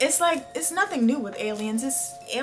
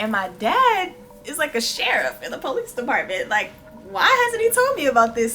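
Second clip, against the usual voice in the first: put-on louder, faster indignant teen; excited teen quote; 2 semitones higher, 9 dB louder than usual. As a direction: rant it like an outraged kid. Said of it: "He was like".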